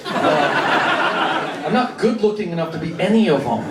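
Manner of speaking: ordinary delivery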